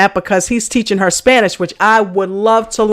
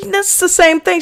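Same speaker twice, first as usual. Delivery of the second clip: higher-pitched, retold brush-off; dismissive retort; 9 semitones higher than usual. Telling how you answered your school friend, higher-pitched brush-off. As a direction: act out your puzzled reply, pitched up. Retelling own reply to friend.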